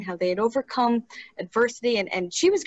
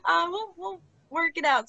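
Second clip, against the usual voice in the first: higher, lightly reassuring; 8 semitones higher than usual. Retold or imitated. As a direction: soothe her with a lift. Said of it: "And I go".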